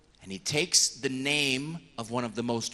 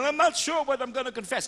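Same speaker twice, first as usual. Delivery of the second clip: nervous penitent; 10 semitones higher, 4 dB louder than usual. Retold or imitated. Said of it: "even when".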